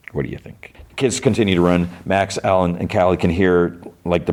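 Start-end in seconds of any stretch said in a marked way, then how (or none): 0.1-0.7 whispered aside. Real, not acted.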